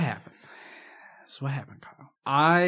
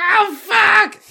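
much higher, louder yelp; mock panicked cry; 10 dB louder than usual. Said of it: "I was like".

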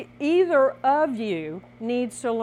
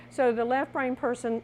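usual speaking voice

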